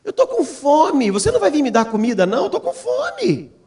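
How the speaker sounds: acted high-pitched yelling; imitated child's cries. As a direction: cry out for Dad like a hungry daughter. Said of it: "os gritos dela".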